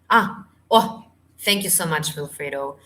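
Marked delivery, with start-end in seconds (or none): none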